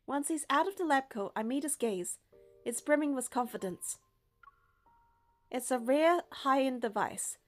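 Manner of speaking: ordinary talk, nothing marked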